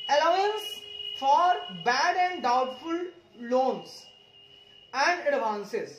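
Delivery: very slow, word by word reading; slow dictation. Shown as a demonstration. Teacher dictating a heading to students, very slow. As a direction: spell the heading out slowly for students to write. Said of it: "teacher reads heading slowly".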